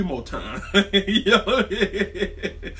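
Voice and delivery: real laugh breaking up the words. Real, not acted. Fan joking about cracking the quarterback harder, laughing.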